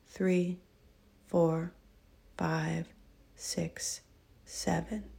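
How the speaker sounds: soft, slow counting of breaths; guided breath count. Acted. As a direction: count them through each breath calmly.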